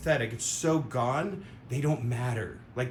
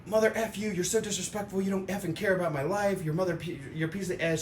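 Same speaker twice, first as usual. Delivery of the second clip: higher-pitched mock cursing; mock angry rant; 5 semitones higher than usual. A hint of angry ranting at a late friend. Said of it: "instead of saying".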